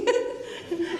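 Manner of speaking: high burst of embarrassed laughter; embarrassed giggle. Real, not acted. Laughs mid-story.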